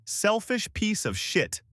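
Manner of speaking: words dragged out and pitched high; slow bitter emphasis. A narrator's reading. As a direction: read each carved word slowly. Carved angry words read.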